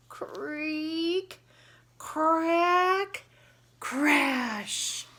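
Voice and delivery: loud, high creak crack crash; sound effects. Acted. Storyteller acting the tree house breaking, loud sound effects.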